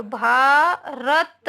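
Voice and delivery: भारत very slow, भा held long, then रत; slow, drawn-out teaching भारत; sounded out. Shown as a demonstration. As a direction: stretch भारत syllable by syllable. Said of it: "ये कैसे उच्चारण होता है".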